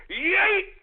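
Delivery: high, punchy yelled yeet; hyped meme cry; yeet shout. Acted. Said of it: "what do the brothers say online".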